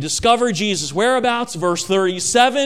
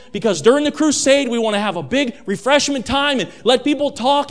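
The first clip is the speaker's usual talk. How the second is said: excited Peter. Acted. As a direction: sell the big plans, building enthusiasm. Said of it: "Now he's starting to get excited".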